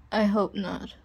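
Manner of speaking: normal talking voice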